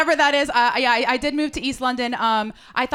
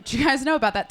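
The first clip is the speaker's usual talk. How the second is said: usual speaking voice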